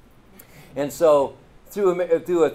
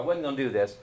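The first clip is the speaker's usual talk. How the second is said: ordinary delivery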